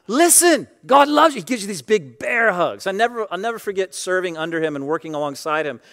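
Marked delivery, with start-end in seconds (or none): 0.1-1.4 high, loud hearty proclamation; exuberant pastor. Retold or imitated. Pastor giving big bear hugs, exuberant shout. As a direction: belt it out like an effusive pastor. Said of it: "And he's like".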